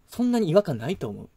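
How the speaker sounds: ordinary talk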